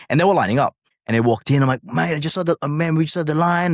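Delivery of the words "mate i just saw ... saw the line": raised, casual American voice; light imitation of an American tourist; American accent. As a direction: play the visiting American. Voicing American band members.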